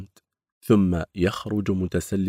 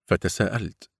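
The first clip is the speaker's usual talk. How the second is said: usual speaking voice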